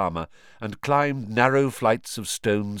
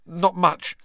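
put-on hasty boy; 9 semitones higher, 5 dB louder than usual. A narrator's reading. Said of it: "hastily wiping the cut".

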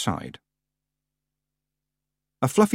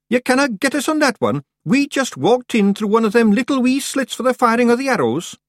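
very high, loud Scots voice; protesting Scottish Feegle; 5 dB louder than usual. Acted. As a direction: wriggle out of blame as a high-voiced Scots clansman.

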